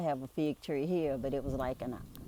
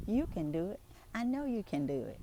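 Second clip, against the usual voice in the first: higher, lilting quote of a mother's encouragement; imitating a mother reassuring a shy child; encouraging mother; 5 semitones higher than usual. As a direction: coax a child along in a higher voice. Voicing mother encouraging her son.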